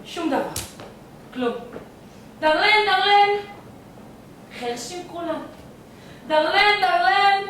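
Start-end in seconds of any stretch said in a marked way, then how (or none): 2.4-3.3 high, sung-out "ding-a-ling" bell; imitated bell ringing. Sick man ringing for unheard servants, bell imitation. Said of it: "אני לא מצלצל חזק מספיק". 6.3-7.4 high, sung-out "ding-a-ling" bell; imitated bell ringing. Sick man ringing for unheard servants, bell imitation. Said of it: "אני לא מצלצל חזק מספיק".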